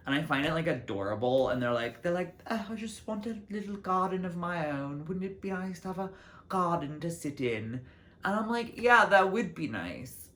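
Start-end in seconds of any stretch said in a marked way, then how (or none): 2.5-7.8 high, wistful mock-British voice; badly faked British homeowner, pitched up; poor British accent. Imitating British couples wishing for a garden, poor British accent. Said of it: "I can't really do a British accent". 8.8-10.3 keen agreement. Retold or imitated. Answers the couples with keen agreement.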